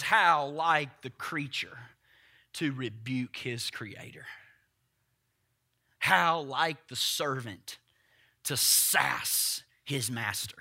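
soft, slow, measured quote; quiet quoted reading. Retold or imitated. Preacher quoting R C Sproul to the church, slow and soft. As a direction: read the theologian's words low and deliberate. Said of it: "R C Sproul, he says here".

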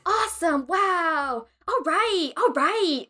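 high, stretched mock enthusiasm; patronizing singsong approval; talking down, as to child. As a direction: coo empty praise to a ten-year-old. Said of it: "I feel like I'm ten".

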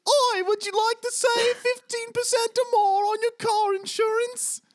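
sing-song like the Geico gecko as Spider-Man; mock Geico Gecko. Host spoofs the gecko ad in a squeaky British voice. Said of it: "Now you're just singing".

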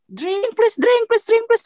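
retold high-pitched yelling plea; teriak memohon. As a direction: imitate frantic begging, pitch shooting up. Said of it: "Aku teriak-teriak kan". (menirukan teriakan memohon, nada melengking tinggi)

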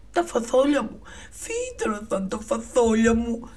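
high, loud joyful cry with a lisp; thrilled boy shouting; excited shout. Acted. Jack shouting with joy at the beanstalk, high and loud. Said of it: "φώναζε, παιδιά, ο Τζακ".

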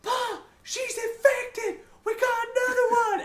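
very high, loud cartoonish wail; mock panic. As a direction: shriek for Pa in hammed-up terror, stretched out. Acting out the killer fleeing in panic.

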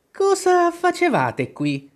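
very high, drawn-out demanding question; an angry queen's piercing interrogation; imperious queen. A narrator's reading. Queen questions gardeners by the rose bush, imperious.

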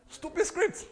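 loud, high-pitched jeer; mock outrage. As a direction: throw it out high as a scornful verdict. Repeats the mock outburst at scripts.

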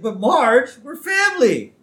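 high, loud protest; whining plea. Retold or imitated. Husband facing a ticket from his wife, pleading. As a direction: plead indignantly with your wife.

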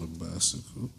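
low, soft echo of a southern twang; imitated southern twang. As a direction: quietly mimic a southern "bicycle". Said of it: "Why you say it like that".

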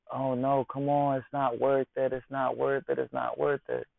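high, pleading "it's not worth it"; mock girlfriend holding him back. Girlfriend begging her man not to fight, high and pleading. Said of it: "your woman is like".

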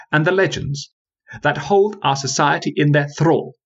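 put-on scornful young woman. Parthenia dismissing the old tales, lofty and scornful. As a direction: lay out the argument with cool contempt.

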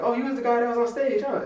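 high-pitched mock fan recognition; imitated excited fan. Club guest recognizing the guy from stage, imitated excitement. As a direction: pitch it way up like an impressed stranger.

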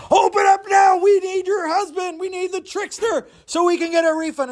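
bang on the door and demand; angry crowd. Acted.

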